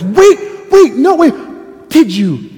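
very high, loud, strained wait; nervous Adam blurting out; frantic pleading. Acted. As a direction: beg desperately to stop him. Adam panics again.

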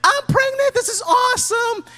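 high, gushing mock excitement; high-pitched happy mom; thrilled mom. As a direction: squeal the good news. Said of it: "what if a mom would say".

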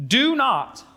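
"Do not" high and punched; "Do not" as a firm, drawn-out order; emphatic command. Acted. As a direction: stress both words of "Do not" firmly. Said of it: "Shulamite emphatically says".

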